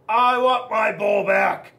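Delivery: slow, loud demand; a loud, stubborn demand for his bowl back; shouting. Retold or imitated. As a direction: shout it again, slowly. Repeats his outburst.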